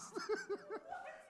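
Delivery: sudden high-pitched laugh; high burst of laughter. Real, not acted. Pastor laughing at his own story, high-pitched.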